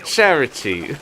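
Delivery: slow mock British text-to-speech voice; bad robot reader. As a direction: imitate the robot alert reading the name. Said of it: "this voice is called Victoria".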